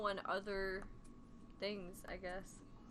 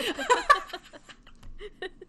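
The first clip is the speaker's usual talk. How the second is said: loud burst of laughter; 10 semitones higher, 9 dB louder than usual. Real, not acted. Laughs after kombucha joke.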